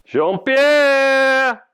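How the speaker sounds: loud, very high, held-out yell; shouted call. Acted. Chef hunting his drunk sous chef, shouted call. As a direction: yell the name through the house. Calling out in the basement search.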